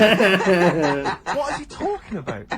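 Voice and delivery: laughing in disbelief. Real, not acted. Incredulous laugh at newspaper columnist.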